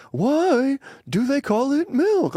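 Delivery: high, slow Seinfeld whine; slow high-pitched Seinfeld bit; Jerry Seinfeld. Retold or imitated. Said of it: "Like the Jerry Seinfeld".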